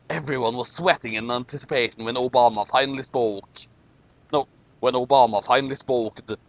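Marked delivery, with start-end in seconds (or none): none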